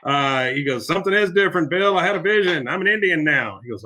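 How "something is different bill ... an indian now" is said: raised, excited mock declaration; hammed-up excited proclamation, higher pitch; proud announcement. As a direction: imitate someone proudly announcing a revelation. Retold character proudly announces his vision.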